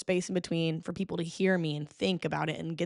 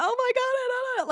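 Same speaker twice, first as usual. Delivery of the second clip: high flustered; faster than usual. Shown as a demonstration. Comic demoing physical bits on stage, high flustered squeal. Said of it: "bend over and say something".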